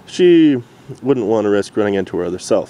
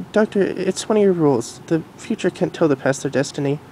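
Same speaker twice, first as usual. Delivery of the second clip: Future Derpy voice; 7 semitones higher than usual. Acted. Future Derpy telling the Doctor, in a high mare voice. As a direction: voice grown-up Derpy gently.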